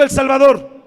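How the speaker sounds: loud, ringing call for the congregation to echo; call and response. Shown as a demonstration. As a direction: lead them in a big shout of "El Salvador" with the pitch dropping hard on the last word. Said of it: "Diga conmigo".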